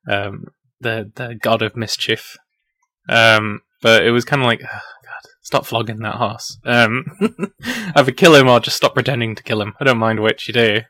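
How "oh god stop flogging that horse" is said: weary, hushed groan; exasperated whisper. Acted. Viewer fed up with Loki's fake deaths, exasperated whisper. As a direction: whisper it under your breath, fed up. Acted weary reaction to plot.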